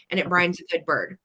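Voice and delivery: normal talking voice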